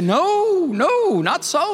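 Peter protesting. Retold or imitated. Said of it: "And Peter said".

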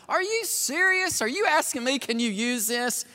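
high, amused disbelief; mock incredulous friend. Pastor friend laughing at the request, high mock disbelief. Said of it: "he was like".

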